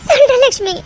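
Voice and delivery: ordinary talk, nothing marked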